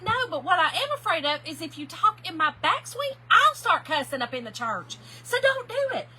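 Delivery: loud playful warning, high at the end; mock threat. Warning her golf partner not to talk on her backswing, loud mock threat. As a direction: lay down the law, laughing inside.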